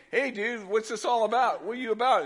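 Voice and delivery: a curious dude asking; high casual dude voice. Acted.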